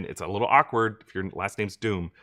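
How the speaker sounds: normal talking voice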